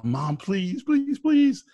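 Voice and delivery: put-on little boy pleading with growing excitement; excited yell. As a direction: son yelling at his mom to let him help, pitch climbing. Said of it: "The son yells at the mom".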